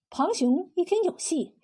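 usual speaking voice

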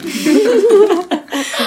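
shrieking burst of laughter; cracking up at a friend's joke; loud high laugh. Real, not acted.

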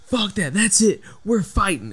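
high, put-on tough-guy challenge; mock angry patient. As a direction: throw down the challenge like an agitated patient. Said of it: "and he's like".

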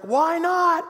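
high, playful questioning lilt; playful high-pitched question; dad to toddler. Retold or imitated. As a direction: ask it as to a small child. Father asking his toddler.

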